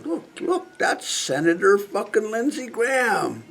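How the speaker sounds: high, hushed fake reverence; mock awe. Mocking the crowd that puts a senator on the throne, in fake awe. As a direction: gush over a celebrity, softly and mockingly.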